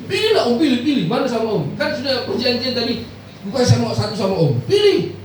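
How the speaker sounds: high, loud pushy offer; excited woman urging the pastor; eager church member. Retold or imitated.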